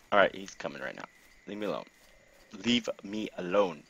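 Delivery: usual speaking voice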